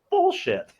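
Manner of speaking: sudden high, loud dismissive snap; a judge calling out a lawyer's claim, in a loud scoff. Retold or imitated. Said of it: "Spiegel just looks at it and goes".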